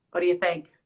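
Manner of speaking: ordinary delivery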